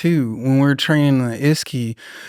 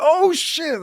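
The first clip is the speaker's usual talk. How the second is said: startled oh shit; 12 semitones higher than usual. Retold or imitated. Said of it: "I'm like".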